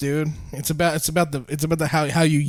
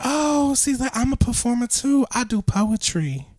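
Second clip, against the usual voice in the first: higher, softer mock woman's voice; imitated woman; 6 semitones higher, 5 dB quieter, slower than usual. Quoting the lady who does poetry, high imitated voice. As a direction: voice her high and light. Voicing woman from his story.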